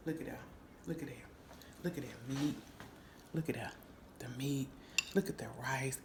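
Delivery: very soft, low marvelling; hushed admiration. Real, not acted. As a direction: murmur low in awe over the food. Marvelling softly over the food.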